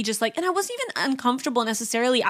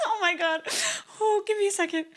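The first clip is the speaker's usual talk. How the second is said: softer, laughing through words; 9 semitones higher, 6 dB quieter, faster than usual. Retold or imitated. Mom laughing at her stuck daughter, softer laughing voice. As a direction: mom cracking up, trying to talk.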